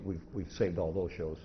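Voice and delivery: ordinary talk, nothing marked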